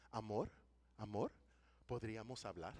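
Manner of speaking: soft, gentle wife's voice, hushed; quiet loving plea to a husband; tender plea. Retold or imitated. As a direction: coax him tenderly into talking. Wife speaking softly to husband.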